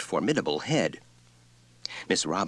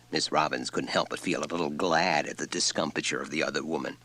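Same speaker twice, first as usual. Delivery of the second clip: ordinary delivery, nothing marked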